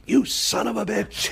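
high, hammed-up director's curse; mock furious curse. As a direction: spit it out like a fuming boss. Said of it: "he was like".

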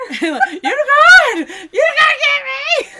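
hammed-up scream for rescue; laughing mock shout. Schmendrick calling out to unicorn.

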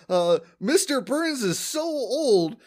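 lightly voiced comedian landing a gag; mock comic punchline. Homer joking about Burns to the crowd, lightly hammed. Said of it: "He's like".

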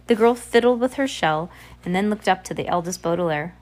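ordinary delivery

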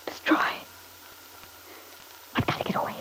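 hushed, breathy whisper to himself; barely voiced, secretive. Alone, plotting escape from Dad, whispered.

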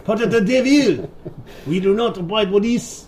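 high, hammed-up French accent; mock French accent. French crew refusing the devil captain, in a high mock French accent. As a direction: play a prim cartoon Frenchman. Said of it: "Hans and Franz".

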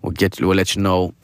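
low, gentle imitation of a kind nurse; caring nurse. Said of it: "caring, like".

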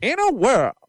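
dramatic high trailer opener; movie trailer voice. Pretend. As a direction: boom out the trailer line. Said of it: "the trailers are gonna have that old-timey news voice".

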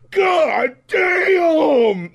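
high, loud, stretched-out mock shout; hammed-up rapper exclamation. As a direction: belt it out, mocking the rapper's catchphrase.

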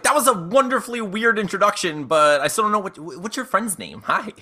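high-pitched, lightly puzzled friendliness; puzzled greeting. Acted. Customer asks showman gnome about his friend, high puzzled politeness. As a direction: lift the pitch, polite but thrown. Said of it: "I'm just like".

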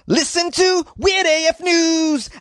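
slow, high-pitched mock shout; drawn-out, high caricature squawk; comic put-on voice. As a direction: holler it slowly in a silly high voice. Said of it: "I don't know why I did that accent".

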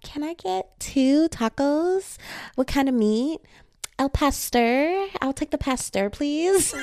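high, drawn-out taco order in a bad accent; horrible Spanish-order accent. Customer attempting Spanish at taco truck, horrible accent. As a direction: stretch the Spanish words in a clumsy accent. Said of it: "These accents are horrible".

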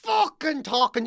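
ordinary talk, nothing marked

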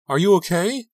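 high, loud, urgent question; alarmed cry. A narrator's reading. Bursting in on a crying friend, crying out in alarm. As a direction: cry it out high, full of worry. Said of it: "I cried".

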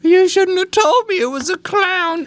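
put-on very high, slow, whiny toddler voice; scared kid voice. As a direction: wail it like a frightened little kid. Child voicing Chucky's fear.

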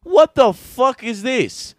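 loud, high, drawn-out mock outrage; hammed-up Italian-American indignation; indignant Italian kid. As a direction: protest slowly and loudly like a tiny wise guy. Tommy objects to nap time.

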